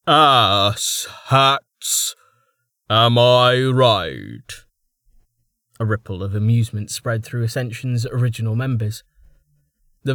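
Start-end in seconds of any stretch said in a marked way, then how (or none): 0.1-4.6 loud, slow grinning jibe; projecting. Acted. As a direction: project it to the crowd. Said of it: "projecting his voice".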